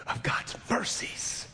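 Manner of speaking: hushed, slow whisper. Real, not acted. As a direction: breathe it out as a secret.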